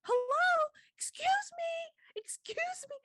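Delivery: put-on very high, desperate little-boy voice, fading to a teary whisper; a small boy begging to be noticed, close to tears; child's voice. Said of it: "And so he's like".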